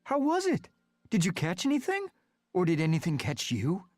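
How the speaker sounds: put-on high, eager young cat asking questions; high excited Smudge. A narrator's reading. Cat friend eagerly quizzing Rusty, high and excited. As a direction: Smudge bursting with curiosity, voice high.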